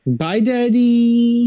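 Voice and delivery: put-on high, slow little-boy farewell; sing-song bye to daddy; childlike goodbye. Pinocchio waving bye to his daddy, high childlike.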